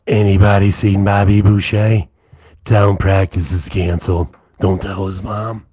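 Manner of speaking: loud yelling Waterboy character voice; Waterboy impersonation. Acted. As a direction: bellow it like the Waterboy's mama. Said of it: "I can do a Waterboy impersonation".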